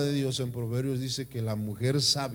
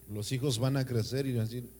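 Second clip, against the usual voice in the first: ordinary delivery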